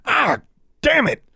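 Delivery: high, loud startled curse; pained outburst. A narrator's reading. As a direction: cry out under a sudden attack. Raven attacks his face.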